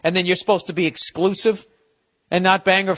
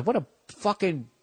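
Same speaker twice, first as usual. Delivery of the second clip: ordinary talk, nothing marked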